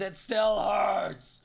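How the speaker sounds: high, loud strained yell of pain; orc yelling. Acted. Orc yells at the potion seller in pain. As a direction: yell it out, hurt and fed up. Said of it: "he goes".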